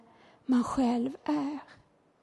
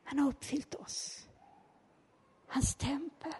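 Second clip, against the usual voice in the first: whispered in awe; hushed whisper; 11 dB quieter than usual. Real, not acted. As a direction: drop to a reverent whisper.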